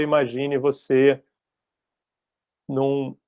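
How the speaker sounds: slow, measured guiding with long pauses; guided meditation leader. Acted. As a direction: lightly imitate someone leading a visualization, unhurried. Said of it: "A pessoa pode tá falando agora".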